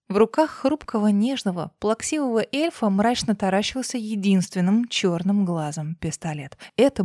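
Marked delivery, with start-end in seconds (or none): none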